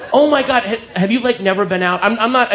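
ordinary delivery

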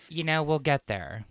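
normal talking voice

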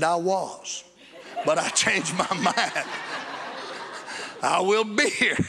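chuckling through the words; a delighted laugh breaking through; laughing reply. Retold or imitated. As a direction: laugh as you tell him you'll wait. Retelling his joyful reply.

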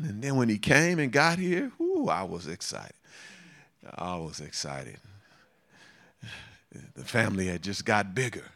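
ordinary talk, nothing marked

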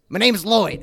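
big, loud in-character hello; brash introduction. Acted. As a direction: announce yourself boldly to the room. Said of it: "And I look up and go".

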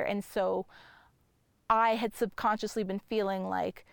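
ordinary talk

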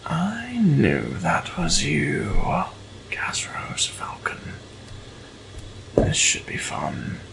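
slow, hushed, intimate challenge. Acted. Captain Senna leaning toward the bard, slow whisper. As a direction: lean in and breathe it.

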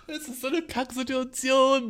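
laughing it out, high and slowed; amused disbelief through laughter; cracking up at an awkward situation. Real, not acted.

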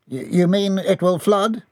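high, stammering question; nervous. A narrator's reading. Battalion member asks about the coming flood, high nervous stammer. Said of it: "started to tremble nervously".

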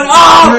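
loud, high "Oh!" of a heckler; hammed-up reaction to a burn; mock taunting "oh". Dark Magician in the back going, mock taunting shout.

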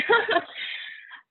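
a breathy laugh that is close to crying; a high, broken laugh fighting back tears; teary laugh. Real, not acted. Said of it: "I think I'm gonna cry".

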